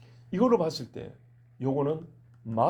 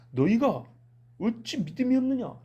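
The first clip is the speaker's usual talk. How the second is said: rebuke; 8 semitones higher than usual. Acted. Jesus rebukes his disciples.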